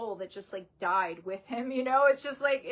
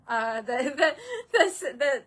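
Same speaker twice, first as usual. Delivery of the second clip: real teary laugh breaking into crying, pitched up and louder; laughing through tears; 3 semitones higher, 5 dB louder than usual. Real, not acted. Owner grieving her dog, voice breaking into a teary laugh. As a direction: she loses her composure mid-sentence, half laughing, half crying.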